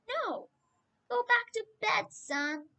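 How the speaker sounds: sleepy murmur, barely awake; half-asleep Eli sends the boy back to bed, drowsy. Acted. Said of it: "said Eli in a sleepy voice".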